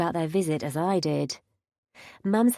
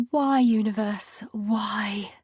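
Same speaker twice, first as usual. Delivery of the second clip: whispered plea to the sky; despairing whisper; 2 semitones higher, much slower than usual. A narrator's reading. Narrator despairs inwardly.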